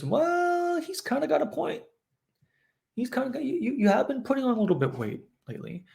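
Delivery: high, sweet little voice of doubt; inner critic voice. Retold or imitated. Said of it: "another voice in her that says".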